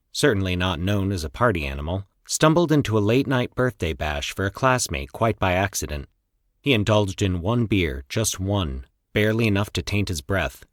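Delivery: ordinary delivery, nothing marked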